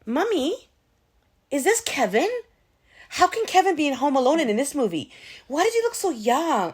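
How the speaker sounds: a hint of an excited little girl; imitating her daughter. Mother quoting her young daughter asking about Kevin, lightly child-voiced. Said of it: "she's like".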